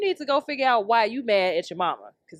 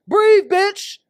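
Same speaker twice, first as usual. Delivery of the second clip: high, loud barked order; mock yelled CPR command; 8 semitones higher, 7 dB louder than usual. A bro doing CPR on his drowning friend, yelling. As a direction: yell it like pumping a chest.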